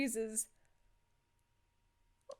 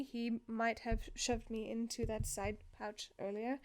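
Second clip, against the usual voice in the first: soft, lowered-volume mumble; mumbled confession; 2 semitones lower, 10 dB quieter than usual. Acted. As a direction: let the awkward admission sink away quietly. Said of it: "you mumbled that last part".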